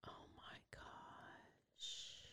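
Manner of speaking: very slow, breathy whisper; horrified whisper. Real, not acted. Listener reacts to the strangling, stunned slow whisper. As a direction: let the shock come out as a breath.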